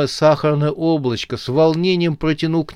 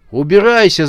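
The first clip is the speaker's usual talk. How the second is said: short shout, higher and louder; крик; 6 semitones higher, 6 dB louder than usual. A narrator's reading. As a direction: bark the command to get out. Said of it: "закричал он". (громкий крик «Убирайся!»)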